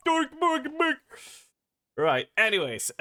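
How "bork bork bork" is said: high mock-Swedish nonsense voice; Swedish Chef. Streamer joking about cancelling him, high mock-Swedish chef. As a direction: chirp cartoon Swedish gibberish. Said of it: "cancel Swedish Chef".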